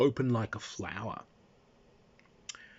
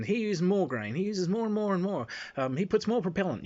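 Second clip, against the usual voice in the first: higher, louder mock bragging; mocking gun bragger; 7 semitones higher, 4 dB louder than usual. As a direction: ham up the know-it-all gun guy. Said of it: "he's telling us".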